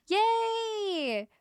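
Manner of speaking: high delighted yay for a friend; excited yay. Real, not acted. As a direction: gasp then a high squealed yay. Gasps at friend news.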